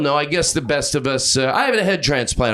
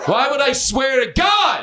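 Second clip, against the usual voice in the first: high, drawn-out exclamation; indignant high-pitched wail to the crowd; mock outraged cry; 8 semitones higher, slower than usual. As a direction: cry it out high, stretching the words. Punchline repeated as a big outburst.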